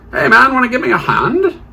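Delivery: high, loud, hammed-up British call for help; British accent. Surgeon flagging down a hospital cleaner, in a high British accent. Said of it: "I burst into a British accent there".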